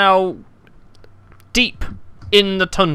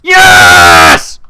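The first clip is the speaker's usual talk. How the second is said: huge triumphant yell, much louder; celebratory shout; 14 semitones lower, 13 dB louder than usual. Real, not acted. Player catching the cat cheers to friends, roaring celebratory yell. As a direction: scream victory at the top of your lungs. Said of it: "that is a celebratory tone".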